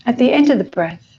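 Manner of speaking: unhurried meditation guide with long pauses; guided meditation. Acted.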